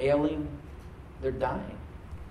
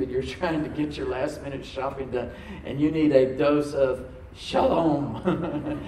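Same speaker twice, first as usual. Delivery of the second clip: usual speaking voice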